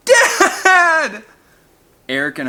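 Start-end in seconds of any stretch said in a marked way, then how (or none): 0.1-1.3 high, loud scream for his father; desperate scream. A narrator's reading. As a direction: scream in despair. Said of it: "Eric screamed".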